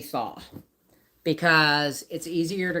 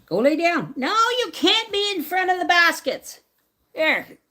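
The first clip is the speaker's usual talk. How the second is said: higher, louder order to the dog; firm command; 13 semitones higher, 5 dB louder than usual. Real, not acted. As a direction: make the dog obey.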